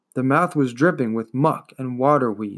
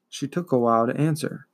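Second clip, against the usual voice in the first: usual speaking voice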